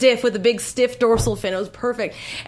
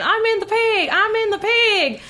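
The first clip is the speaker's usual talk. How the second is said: high, drawn-out Southern practice line; high Southern character voice; Jamie Presley; 11 semitones higher, slower than usual. Shown as a demonstration. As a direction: stretch it out in a high Southern twang. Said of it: "they just use that one line over".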